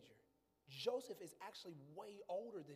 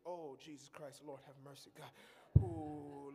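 ordinary delivery

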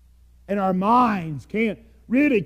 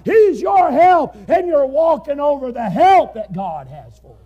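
loud, raised preaching voice; fiery preaching; 7 semitones higher, 8 dB louder than usual. Acted. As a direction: press the point hard on the congregation. Preaching at full force.

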